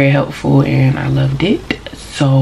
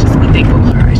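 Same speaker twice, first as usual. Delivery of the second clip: whispered advice; 4 dB louder than usual. Shown as a demonstration. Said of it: "repeats the advice again".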